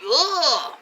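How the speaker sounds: high mock yelp of a hurt dog; imitating a yelping dog. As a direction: squeal like a startled dog. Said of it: "He's like".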